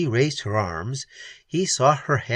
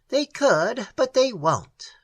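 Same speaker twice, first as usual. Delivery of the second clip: retort; 11 semitones higher, slower than usual. Acted. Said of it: "retorted the old man".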